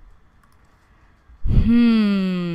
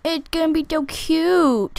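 ordinary talk

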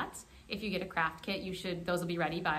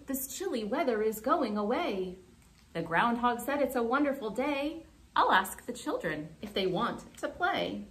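lively, measured storytime narration; unhurried, sing-song picture-book reader; children's story reading; 3 semitones higher, 4 dB louder, slower than usual. A narrator's reading. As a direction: read to young children with big pitch swings.

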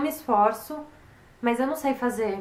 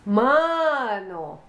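drawn-out exclamation; 4 dB louder than usual. Shown as a demonstration. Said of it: "as sete coisas que você mais fala".

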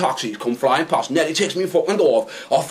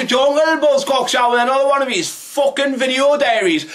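shouting; 8 semitones higher than usual. Acted. Shouted vlog greeting.

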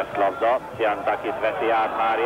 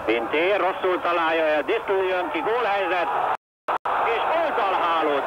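excited sports commentary; 7 semitones higher than usual. Real, not acted. Near miss, goal chance.

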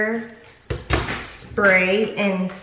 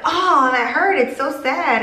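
higher, louder mock pain whine; hammed-up hurt whine; 7 semitones higher, 3 dB louder than usual. Mom whines to her daughter about a popped press-on nail, hammed up. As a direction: overplay the sting of a popped nail. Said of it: "And I went".

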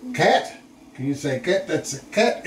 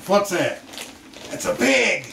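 ordinary delivery

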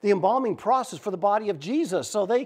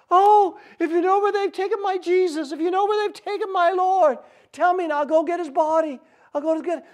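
high, loud, impassioned plea; desperate pleading; 11 semitones higher, 5 dB louder than usual. Retold or imitated. Weeping Mary begging the gardener, desperate pleading. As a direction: beg with everything, voice pitched right up.

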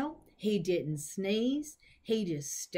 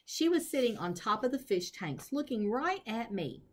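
ordinary delivery

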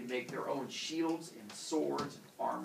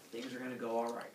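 ordinary delivery, nothing marked

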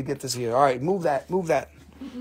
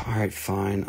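ordinary talk

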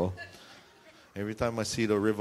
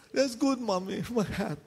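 high, fussing about his hat; distracted husband; 11 semitones higher than usual. Husband brushing off his wife, high fretful. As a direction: brush her off, fretting high. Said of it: "he's like".

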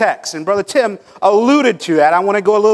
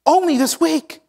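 a hint of a boastful pastor, higher at the end; mock proud boast; 5 semitones higher than usual. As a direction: lightly ham up a self-satisfied church report. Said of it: "and say".

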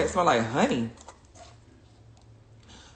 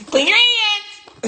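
high, slow singsong "Clean your hands"; mock singsong nag; 14 semitones higher, 4 dB louder, much slower than usual. Mocking the viewer who keeps posting the reminder, singsong.

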